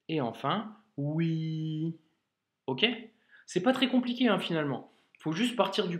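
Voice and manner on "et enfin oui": oui said slowly and drawn out; slow demo of oui. Shown as a demonstration. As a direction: stretch the vowel of oui slowly. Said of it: "nous avons wa". (oui lent et allongé)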